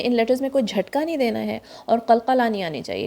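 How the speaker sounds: ordinary talk, nothing marked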